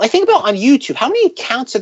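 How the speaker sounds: normal talking voice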